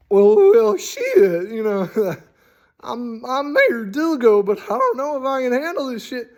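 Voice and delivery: high, anxious voice of an overwhelmed small-town mayor; worried, high-pitched fretting; panicked mayor. Pretend. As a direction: sound like the mayor is out of his depth.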